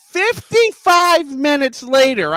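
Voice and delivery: high, slow, stretched-out complaint; exasperated disbelief. Real, not acted. Customer telling how late his wraps came, exasperated disbelief. Outrage at the long wait.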